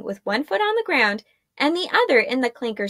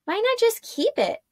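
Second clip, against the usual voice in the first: put-on higher, curious girl's voice; young woman Arella; 12 semitones higher than usual. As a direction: ask him lightly.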